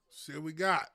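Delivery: ordinary talk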